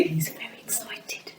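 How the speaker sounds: put-on whispered aside to the children; conspiratorial stage whisper. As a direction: lean in and whisper it like a secret.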